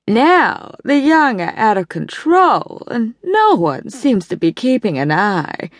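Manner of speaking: a judgmental matron lamenting modern youth; Evelyn's voice. A narrator's reading. Evelyn scolding the young over bridge, in a prim Boston drawl.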